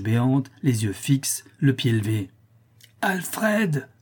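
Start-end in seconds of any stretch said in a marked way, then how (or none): 3.0-3.9 high, drawn-out name call; wife calling her husband sharply; shrill cry. A narrator's reading. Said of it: "cria de nouveau madame Pipelet".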